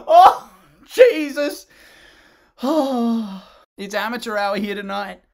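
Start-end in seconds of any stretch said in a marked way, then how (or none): 0.1-3.4 loud, high, slow groan of disbelief; exasperated cry. Real, not acted. Reviewer laughs and groans at the swaying frozen actors. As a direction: cringe out loud.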